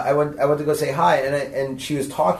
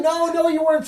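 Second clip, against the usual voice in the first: mock reassuring, high; 12 semitones higher than usual. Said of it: "And I was like".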